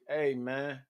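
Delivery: low, soft smooth hey man; lightly voiced smooth actor; Taye Diggs impression. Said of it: "my Tay Diggs voice".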